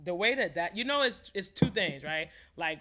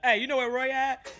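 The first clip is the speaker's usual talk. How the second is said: loud, raised-pitch mock call to passers-by; 9 semitones higher, 10 dB louder than usual. Imagined visitor asking around the Bronx, loud mock call. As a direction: holler it like asking around the block.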